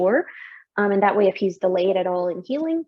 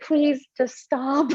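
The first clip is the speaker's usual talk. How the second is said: pleading; 5 semitones higher, much slower than usual. Retold or imitated. Retold plea during exam.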